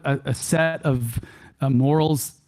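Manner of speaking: usual speaking voice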